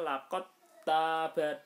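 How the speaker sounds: "Laqad taba" recited loud, the d dropped into a doubled t; "Laqad taba" in loud tajwid recitation, no d heard; merged dal into ta. Shown as a demonstration. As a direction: chant "Laqad taba" as laqat-taba, long first a in taba. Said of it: "dalnya di-, dihilangkan".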